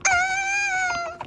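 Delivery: high, loud sustained shriek; long scream. Pretend. Quarrel at the food truck, long high scream. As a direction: hold one piercing scream.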